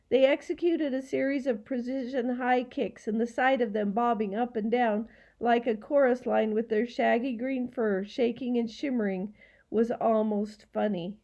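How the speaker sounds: ordinary delivery, nothing marked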